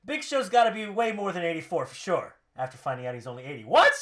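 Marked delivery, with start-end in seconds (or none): 3.6-3.9 loud, high disbelieving yelp; sudden indignant shout; shocked outburst. Acted. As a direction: explode in mock disbelief. Acting Rusev's shock at rating.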